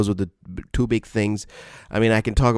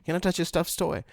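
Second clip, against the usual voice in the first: mock asking; 8 semitones higher, 5 dB quieter, faster than usual. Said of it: "somebody comes in and says".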